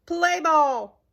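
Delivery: high, loud umpire call; umpire's yell. Acted. Umpire starts the game for the crowd, loud yell. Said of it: "He yelled".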